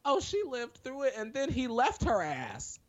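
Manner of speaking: high, incredulous retelling. Real, not acted.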